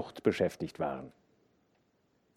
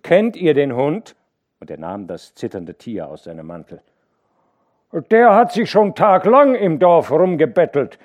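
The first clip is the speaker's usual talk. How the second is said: raised questioning; 7 semitones higher, 10 dB louder than usual. A narrator's reading. Hauke asking the overseers about the dog. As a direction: hold the dog up and ask.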